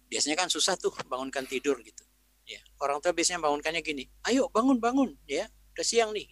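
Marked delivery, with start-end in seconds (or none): 4.2-6.3 raised, higher parent voice; louder, pitched-up rousing call; urging wake-up call. Acted. Parent rousing a child from sleep, urging wake-up call.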